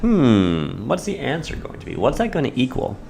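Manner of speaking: high, hushed teasing question; quizzing voice. Acted. Teacher teasing students with a high hushed question. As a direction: lift it up and pull the volume down to tease the class.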